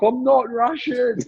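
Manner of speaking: high, drawn-out retold wail; slow, high-pitched mock outcry; mock protesting cry. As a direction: act out yelling a protest on the run. Retold protest while running.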